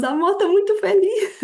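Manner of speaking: high-pitched, laughing mock celebration; retold elation. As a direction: gush to your partner through a laugh. (alegria imitada, aguda e risonha)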